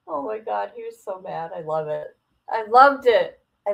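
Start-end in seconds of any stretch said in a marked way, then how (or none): none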